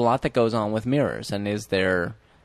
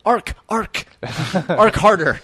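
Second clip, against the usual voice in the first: mock urging; 5 semitones higher, slower than usual. Mock director urging harder.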